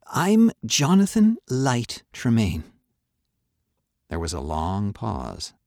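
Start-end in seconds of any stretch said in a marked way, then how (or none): 0.1-2.6 put-on boy's earnest voice, slow and higher; Johnny introducing himself. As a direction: state the name carefully, slowly. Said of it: "said Johnny".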